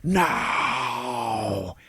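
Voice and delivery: long, drawn-out mock roar; acted scream without full volume; monstrous scream. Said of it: "roars at him ... fangs and screams".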